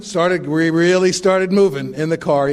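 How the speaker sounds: ordinary delivery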